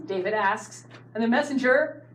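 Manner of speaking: ordinary talk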